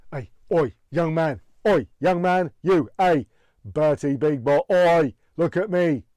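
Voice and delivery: angry chef shouting; loud, insistent barks; Gordon Ramsay. Acted. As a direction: holler for attention, annoyed. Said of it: "sound angry and annoyed".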